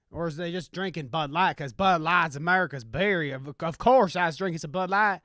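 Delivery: put-on indignant country bumpkin in a Southern accent. Beer drinker griping about Bud Light, Southern country accent. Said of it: "country accent and my rendition of a Southern".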